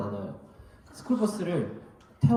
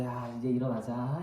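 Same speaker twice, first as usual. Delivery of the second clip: soft coaxing; 4 semitones lower, 5 dB quieter than usual. Retold or imitated. Parent waking child gently.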